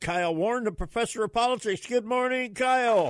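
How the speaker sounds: high, loud, cheery on-air welcome; excited greeting. Real, not acted. Radio host greeting his guest on the air, high and excited. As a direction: greet the guest with a big lift.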